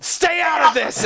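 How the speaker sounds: high, slow angry shout; mad shout. Acted. Enraged guard yelling at the interfering paladin, slow angry shout. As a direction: roar it at the meddler. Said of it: "He gets mad with".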